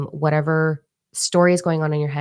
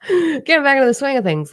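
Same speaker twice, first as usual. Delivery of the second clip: high sheepish laugh carried into the words; real laugh; 10 semitones higher, 5 dB louder than usual. Real, not acted. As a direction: laugh it off while talking, embarrassed. Laughs at her own swearing.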